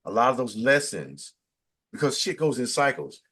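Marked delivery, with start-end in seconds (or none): none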